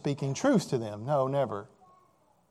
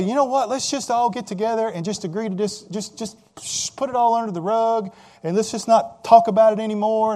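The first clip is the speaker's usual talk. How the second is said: mock glib peacemaker; 5 semitones higher, 5 dB louder than usual. Mock quoting easy peacemaker.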